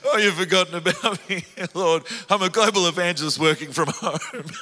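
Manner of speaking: high, loud laughing lament; mock lament breaking into laughter; laughing self-pity. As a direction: complain to God while laughing.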